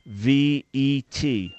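each letter spaced apart; slow spelling out. Shown as a demonstration. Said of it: "you could spell it M A".

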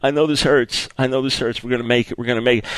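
low, softened words of comfort; tender consoling. Acted. Friend hugging a bereaved man, tenderly consoling. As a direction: console gently, as if holding someone. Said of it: "hugging them and crying with them".

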